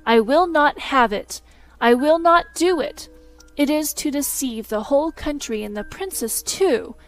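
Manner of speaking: loud, rising fury, stamping each no; he won't be made a shadow; furious refusal. Acted. Learned man threatens to expose the shadow, furious.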